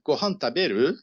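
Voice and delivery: "Gohan taberu" with a clear rise at the end of "taberu"; question intonation. Shown as a demonstration. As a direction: turn "Gohan taberu" into a question by lifting the ending. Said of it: "Com a entonação a gente faz a pergunta". ("Gohan taberu" com entonação de pergunta)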